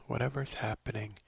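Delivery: normal talking voice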